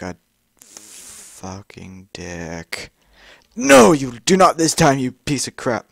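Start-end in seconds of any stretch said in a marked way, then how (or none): 3.6-5.9 taunting shout. Real, not acted. Gamer taunting game enemy.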